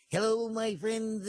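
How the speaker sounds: high, slow preacher's greeting; Ernest Angley impression. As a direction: greet the flock in drawn-out mock-preacher tones. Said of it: "here is Reverend Ernest Ainsley".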